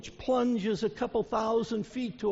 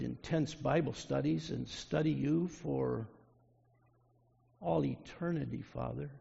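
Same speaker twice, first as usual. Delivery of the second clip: prayer; 4 semitones lower than usual. Real, not acted. Leads a closing prayer.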